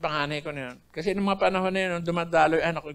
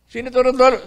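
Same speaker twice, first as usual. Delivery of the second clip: louder, raised roll-call voice; guard calling out; 4 semitones higher, 6 dB louder than usual. Retold or imitated. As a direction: call the name across the cell block. Guard calls out prisoner's name.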